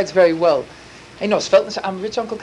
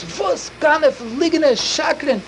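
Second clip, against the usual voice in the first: angry accusation; 6 semitones higher, much slower than usual. Retold or imitated.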